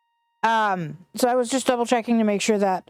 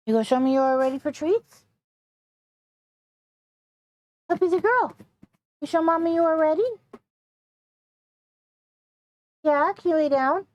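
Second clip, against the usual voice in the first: talking to a dog; 8 semitones higher than usual. Real, not acted. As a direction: coax the puppy, high and sweet. Coaxing the dog to lie down.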